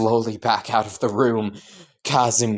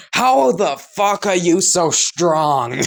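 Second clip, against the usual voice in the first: high, loud, slowed shout; boy shouting as he is dragged along; exasperated shout; 7 semitones higher, 6 dB louder, slower than usual. Acted. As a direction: yell it in disbelief while dragged.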